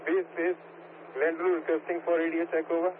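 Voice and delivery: tinny voice over a radio; crackly two-way radio transmission; crackly military radio. Real, not acted. Radio check call again.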